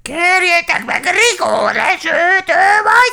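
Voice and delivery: very high, loud screech; piercing, quarrelsome shriek; arguing crow. A narrator's reading. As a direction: squawk back defiantly as a crow.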